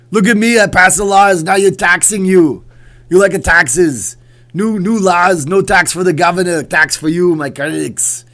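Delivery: shouted, high-pitched cartoon impression; Arnold. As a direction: bellow like a cartoon Austrian governor. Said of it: "He does the Arnold voice".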